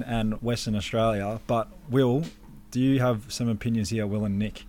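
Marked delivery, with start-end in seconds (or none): none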